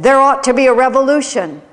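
frustrated outburst. Retold or imitated. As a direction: retell it as a forceful, exasperated proclamation. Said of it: "spoke with great frustration".

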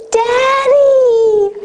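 put-on high, loud delighted little-girl call; little daughter calling across the hill to her father, high and loud; joyful child calling. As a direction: call out like a small child running to her dad. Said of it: "like this".

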